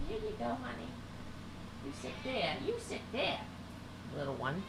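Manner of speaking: very soft, away from the mic; soothing pet talk. Real, not acted. Host settling the cat down, very softly. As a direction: settle a small animal very quietly.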